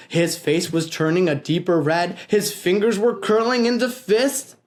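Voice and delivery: usual speaking voice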